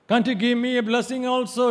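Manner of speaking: high, loud plea; crying out. Retold or imitated. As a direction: cry out desperately to father. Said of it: "he cried out to his father".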